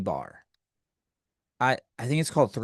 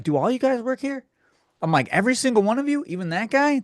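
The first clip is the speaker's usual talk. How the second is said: pitched high in disbelief; can't believe it, push the voice up; incredulous questions; 8 semitones higher, faster than usual. Retold or imitated. Server quizzing the girl, high incredulous disbelief.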